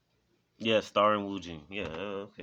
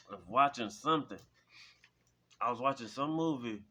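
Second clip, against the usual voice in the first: ordinary delivery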